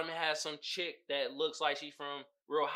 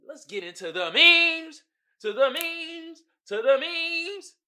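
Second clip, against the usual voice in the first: high, drawn-out hype call; hyped segment intro; 10 semitones higher, 6 dB louder, slower than usual. Real, not acted. Host hyping listeners for meme time, high drawn-out call. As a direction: stretch it out like a segment jingle.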